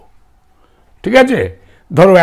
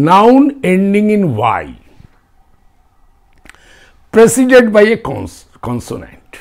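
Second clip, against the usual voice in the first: slow dictation; 4 semitones higher, much slower than usual. Shown as a demonstration. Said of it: "dictating rule three".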